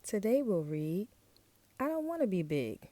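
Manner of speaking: ordinary delivery, nothing marked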